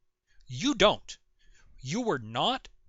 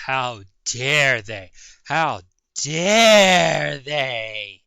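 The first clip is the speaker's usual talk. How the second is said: mock outrage; 3 semitones lower, 11 dB louder, much slower than usual. Sarcastic mock outrage at clip.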